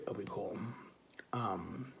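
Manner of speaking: sudden soft whisper. Real, not acted. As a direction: drop to a secret whisper. Drops into an aside.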